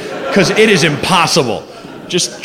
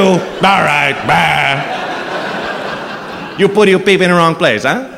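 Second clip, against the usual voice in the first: breezy Italian doctor delivering bad news; a cheery Italian doctor shrugging off a diagnosis; Italian accent; slower than usual. Doctor tells patient he has syphilis, jolly Italian accent. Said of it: "with that accent".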